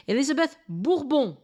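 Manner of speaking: Bourbon said the French way, with nasal final vowel; French-style "Bourbon", ending on a nasal "on"; French pronunciation. Shown as a demonstration. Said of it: "say it the French way".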